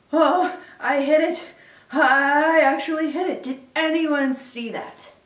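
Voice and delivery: high, loud triumphant exclamations; excited outburst. Acted. Hiccup boasts that he actually hit it, elated. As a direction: exclaim over an astonishing hit.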